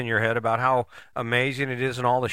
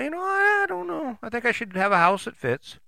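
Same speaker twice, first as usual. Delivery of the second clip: much higher, hesitant inner voice; musing quote; 11 semitones higher than usual. Retold or imitated. Talking yourself into a smaller house, high hesitant musing. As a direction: voice your own hesitant thought up high. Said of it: "just go ahead and decide".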